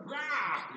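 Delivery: a mock growl to scare the kids. Grandpa as goblin growling at the kids, playful mock growl. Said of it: "I growled".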